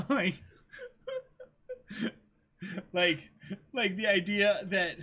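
laughing. Real, not acted. Laughing while talking.

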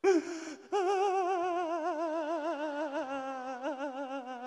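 long, high wail with vibrato; drawn-out operatic cry; sung opera wail. Acted. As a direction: sustain a theatrical, wavering lament. Demonstrates tearful opera wail.

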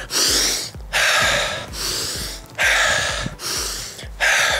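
slow deep breath in and out. Shown as a demonstration. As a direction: take a calming breath. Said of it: "focus on your breathing".

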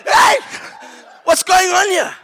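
high, loud startled yell; shocked yell. Retold or imitated. Retelling the grab under the water, a high startled yell. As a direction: yelp it out in outrage. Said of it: "So I'm like".

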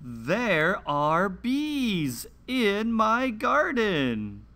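slow, clear picture-book read-aloud with long pauses; reading to children. Acted. Host reading a garden picture book to young children, slow and clear. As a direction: read each page slowly for little listeners.

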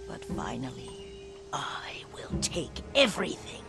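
very soft, low, whispery threat; menacing threat. Acted. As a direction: savour the threat under your breath. Sister threatens to take everything.

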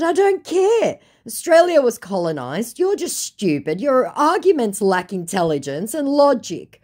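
high, loud dismissive scolding; exasperated retort. Retold or imitated. Woman dismissing the scammer's argument, exasperated.

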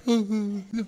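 mock flustered stammer; the class caught out, stammering; confused kids. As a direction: imitate the kids' confused stammer. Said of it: "Everybody's like".

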